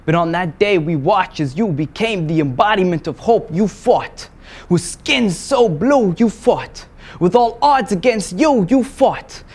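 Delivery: high, loud declaiming, building to a peak; rising, urgent spoken word delivery; impassioned slam poetry. Acted. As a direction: hammer each refrain with full force. Slam poem builds in force.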